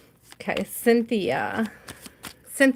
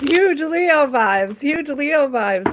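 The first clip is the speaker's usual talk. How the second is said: higher, louder, slow and drawn-out, hammed-up prophecy; mock oracle pronouncement; 6 semitones higher, 4 dB louder, slower than usual. Replaying her catchphrase to viewers as a hammed-up prophecy. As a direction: announce it like a prophet, tongue in cheek.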